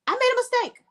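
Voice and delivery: high, hammed-up "I made a mistake"; sarcastic fake remorse, pitched high; mock apology. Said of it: "he was gonna be like".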